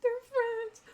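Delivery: high, loud squeal of delight; gushing fangirl squeal of joy; squealed quote. Retold or imitated. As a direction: shriek it like your heart just burst. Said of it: "I'm just like".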